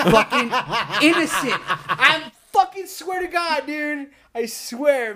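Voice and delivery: high, laughing protest; laughing insistence. Real, not acted. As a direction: swear it's true through real laughter. Laughing while swearing it is true.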